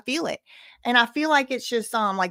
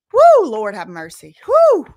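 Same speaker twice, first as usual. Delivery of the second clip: loud exclamation; 11 dB louder, slower than usual. Real, not acted. Streamer hollering at nasty chat, loud woo. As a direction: holler to the Lord in disbelief.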